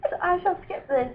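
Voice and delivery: put-on hushed, mannered British accent; British accent that could pass for Irish or Scottish. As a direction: speak in a muddled, soft-spoken British accent. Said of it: "going with the British accent, are we?".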